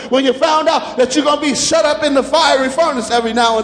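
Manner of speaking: ringing sermon cadence, pushing each line harder; preaching at full force. Acted. Preacher rallying the congregation, in full-force preaching cadence.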